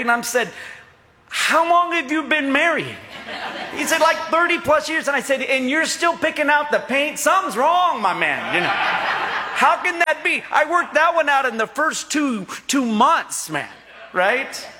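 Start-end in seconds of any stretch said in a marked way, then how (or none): 1.3-3.0 higher, teasing disbelief; incredulous question. Retold or imitated. Pastor needling an old man at Home Depot, teasing. 4.0-5.1 laughing retort. Retold or imitated. Old man telling pastor his marriage years, laughing. 5.4-10.4 higher, amused disbelief; laughing tease. Retold or imitated. Pastor teasing old man about picking paint, laughing.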